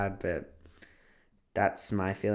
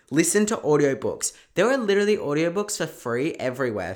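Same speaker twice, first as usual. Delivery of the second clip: ordinary delivery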